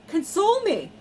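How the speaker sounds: high, loud on console; emphatic. Real, not acted. Fan insisting nothing can console her, high and emphatic. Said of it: "You cannot".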